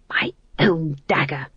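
slow stunned whisper. Acted. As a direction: breathe it in disbelief. Ghost king realizes betrayal.

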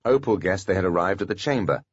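plain reading voice

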